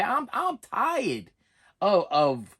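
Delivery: ordinary talk, nothing marked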